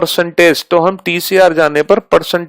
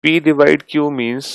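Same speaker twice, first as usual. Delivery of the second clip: slow, quieter dictation with long pauses; 3 semitones lower, 5 dB quieter, slower than usual. Shown as a demonstration. Teacher dictates new symbol rules slowly for the class to note. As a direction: dictate each rule slowly for students to copy.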